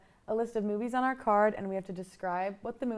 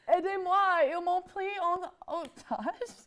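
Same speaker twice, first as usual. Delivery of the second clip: hostage plea; 7 semitones higher than usual. Pretend. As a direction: plead like a captive. Fake hostage plea in French.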